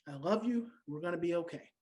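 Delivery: put-on soft, calm, reassuring to a child; calm reassurance. Shown as a demonstration. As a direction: say it gently to soothe an upset kid.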